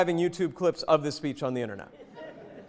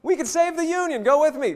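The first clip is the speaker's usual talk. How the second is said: much higher and louder rallying call; rousing battle cry; 10 semitones higher, 4 dB louder than usual. Pretend. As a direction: lift the men up, full of fire. Teacher echoes audience answer, rousing.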